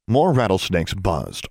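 ordinary delivery, nothing marked

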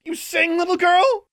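high, loud jeering shout; taunting shout. Acted. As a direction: jeer at her from a cell. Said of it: "A woman shouted".

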